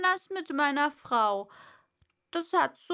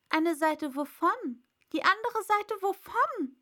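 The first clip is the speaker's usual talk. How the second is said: high, baffled questions rising in pitch; bewildered Alice; puzzled; 8 semitones higher, 3 dB louder than usual. A narrator's reading. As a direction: echo it back confused. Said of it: "dachte Alice bei sich".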